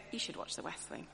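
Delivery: soft, low, barely voiced suggestion; flat mild suggestion. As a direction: toss it off quietly, no push. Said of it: "We don't go".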